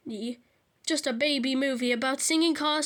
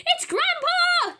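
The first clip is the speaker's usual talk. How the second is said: a much higher, louder, drawn-out shout; slow, alarmed cry to everyone; 7 dB louder, slower than usual. A narrator's reading. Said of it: "shouted Perfect Peter".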